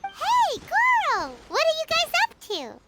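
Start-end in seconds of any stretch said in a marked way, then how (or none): 0.0-1.3 high, bright call across the beach. Acted. Paimon spotting Goro and calling him over, a high call. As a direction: hail him from a distance.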